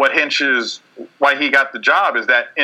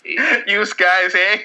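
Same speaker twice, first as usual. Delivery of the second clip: put-on Canadian accent; 5 semitones higher, much slower than usual. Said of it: "he's a Canadian".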